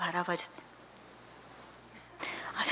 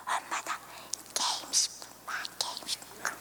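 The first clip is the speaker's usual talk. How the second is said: 숨소리로 작게 게임 조르기; 엄마 바지 당기며 몰래 조르듯; 속삭이듯 조르는 아이; 11 dB quieter than usual. Retold or imitated. 아이가 바지 당기며 조름. (작게 속삭이며 조르는 아이)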